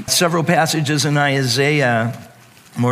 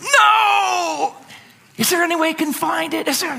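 panicked cry. Acted. Someone who hit delete by accident, in a high panicked shout. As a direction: shriek it out like you just lost everything.

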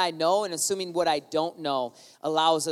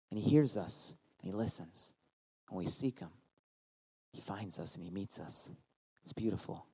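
much lower, quiet and tender; soft and intimate; 10 semitones lower, 8 dB quieter than usual. Real, not acted. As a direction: draw them in close, softly.

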